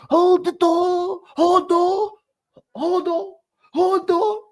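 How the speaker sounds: high, slow desperate cry; Hodor cry. Retold or imitated. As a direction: wail it like Hodor. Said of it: "Game of Thrones, what's his name".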